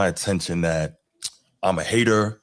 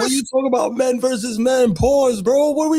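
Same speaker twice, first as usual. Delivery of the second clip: high, loud mock outrage; mock outraged shout; 4 dB louder than usual. Co-host hamming up outrage over the word pause, loud. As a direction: yell it like a scandalized fan.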